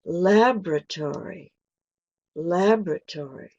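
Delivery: American laboratory stressed on lab, with a full tory ending; American pronunciation. Shown as a demonstration. Teacher contrasting British and American English, American. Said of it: "in an American way".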